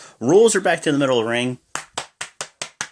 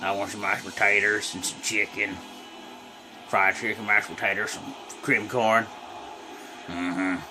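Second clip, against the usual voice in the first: lightly lowered put-on tough-guy voice; a hint of a lazy character ordering food; Switchblade character; 5 semitones lower, 7 dB quieter than usual. Plays a character named Switchblade.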